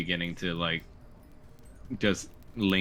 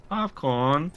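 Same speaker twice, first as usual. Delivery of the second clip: high, fake-accented little boy; earnest son, pitched high; put-on child accent; 8 semitones higher, 3 dB louder than usual. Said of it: "might wanna drop the accent".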